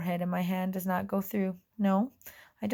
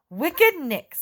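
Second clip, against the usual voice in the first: loud, higher angry call; 8 semitones higher, 8 dB louder than usual. Acted. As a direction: call out angrily. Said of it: "says an angry voice from outside".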